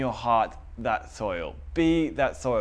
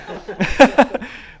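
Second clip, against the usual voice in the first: high laugh; 10 semitones higher, 9 dB louder than usual. Real, not acted. Laugh mid-sermon.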